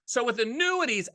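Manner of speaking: ordinary talk, nothing marked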